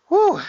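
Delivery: a high, loud whistling exhale of effort; gasping whew from a workout; sharp high whew. Real, not acted. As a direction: blow out hard after the set. Exhale after hard set.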